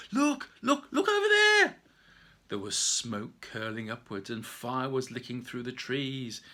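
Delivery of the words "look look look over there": very high, loud alarmed shout; shouting at the top of voice. Acted. Safia yelling to everyone at the fire, top of her voice. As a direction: scream it out to point the danger. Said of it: "she shouted out at the top of her voice".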